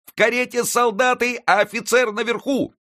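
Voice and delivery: put-on very high excited boy's yell; boy's shout. A narrator's reading. Friquet on the carriage roof shouting to the crowd, high boy's yell. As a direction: call the crowd to arms.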